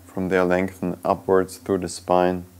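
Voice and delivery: soft meditation voice with long, unhurried pauses; guided meditation. Real, not acted. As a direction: guide them gently inward, leaving silence between phrases.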